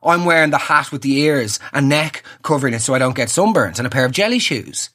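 ordinary talk

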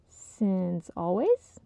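drawn out, teasing; slow teasing question. Acted. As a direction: stretch it out slowly.

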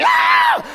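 high falsetto shriek imitating a wife; hammed-up movie-scare scream; long mock shriek of panic; panicked scream. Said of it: "as she's panicking".

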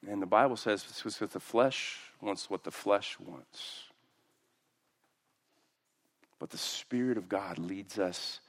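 usual speaking voice

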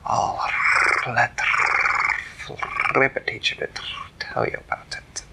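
whispery cat voice with purrs; hushed, rolling feline voice; purring cat. Acted. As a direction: purr the words out like a cat. Theodore the purring cat replies.

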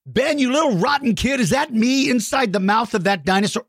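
high-pitched, playfully outraged telling-off; mock scolding. Host to kid who drew him eaten, mock outraged.

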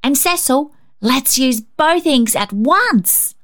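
put-on high, slow eager child voice; drawn-out daring suggestion; eager girl. A narrator's reading. Rhonda urging Cecil to use both inks, high eager voice.

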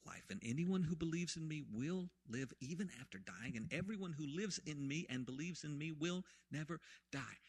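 normal talking voice

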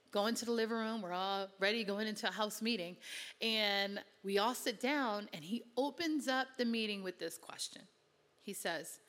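ordinary talk

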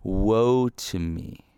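slow, grieving cry of woe; mournful lament. Acted. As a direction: draw it out like a lament. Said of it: "I love this way that Isaiah expresses".

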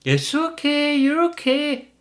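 put-on high, sing-song baby talk to the dog; mocking a doting dog owner's high coo. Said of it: "you do go like this".

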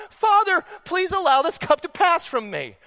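high, loud anguished plea; pleading cry to the Father. A narrator's reading. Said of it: "he cries out".

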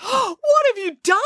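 high panicked Ali Baba voice; cried out. A narrator's reading. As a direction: shriek it in shock. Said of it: "cried Ali Baba".

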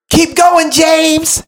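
high sing-song call from above; high chirpy girl voice calling out; mock cheerful girl. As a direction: call down sweetly from the top. Rival calls down from mountain.

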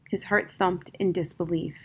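plain reading voice

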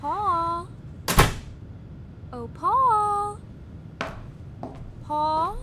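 high, loud call across the house. Acted. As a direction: call him from another room. Calling for husband through house.

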